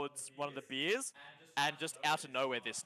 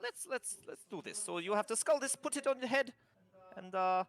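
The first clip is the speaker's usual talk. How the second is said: boisterous dwarf; 10 semitones higher than usual. Acted. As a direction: bellow the rules like a tavern dwarf. DM voicing dwarf stating rules.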